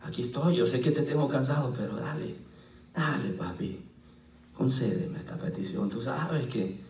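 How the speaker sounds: soft, low, pleading prayer; quiet prayer. Retold or imitated. Pastor retelling his prayer to God, soft and pleading. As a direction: murmur it to God like a child asking. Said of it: "le digo, Dios".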